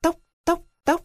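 high, light knocking sound "tốc, tốc"; tapping sound. Acted. Beak tapping the eggshell, high knocking. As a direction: tap it out like a beak on a shell.

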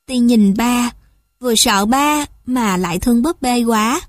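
plain reading voice

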